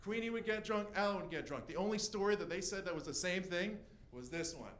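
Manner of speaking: ordinary delivery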